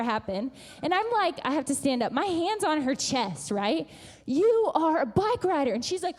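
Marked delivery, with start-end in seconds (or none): 4.3-5.7 loud, higher rallying declaration; pumping her up. Retold or imitated. Mother with hand on child's chest, rallying. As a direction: drive it home like a huddle coach. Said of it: "he's pumping them up".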